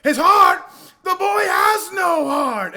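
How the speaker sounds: high, booming movie-villain shout; hammy villain roar. Acted. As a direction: bellow it to a roaring arena, stretched out. Acting Bill roaring to the crowd.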